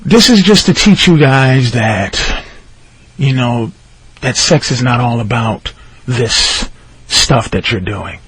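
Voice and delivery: low, slow, soft judge's lecture; measured, low-voiced judge imitation. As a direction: hand down a lesson slowly and quietly. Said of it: "This is what he said".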